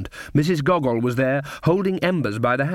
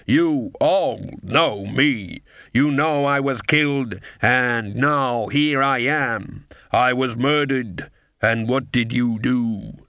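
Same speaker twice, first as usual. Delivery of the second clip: very slow, drawn-out figure voice; slow, weighty, measured accusation; Baron Saturday, solemn accusation; slower than usual. Acted. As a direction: stretch every word like a risen dead man addressing a crowd.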